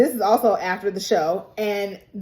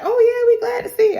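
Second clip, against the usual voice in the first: a much higher, louder, fast gushing welcome; mock cheerful welcome; 6 dB louder, faster than usual. Bandmates greeting the returning bandmate, high, loud and gushing. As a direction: gush like a whole crowd greeting an old friend. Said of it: "'Cause everybody was like".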